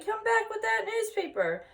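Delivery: high yell after the thief. A narrator's reading. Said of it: "yelled the boy".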